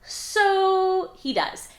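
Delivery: dramatic reveal. Acted. Recapping the fight, announces the hero gives in, dramatic. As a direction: stretch it out like a big plot turn.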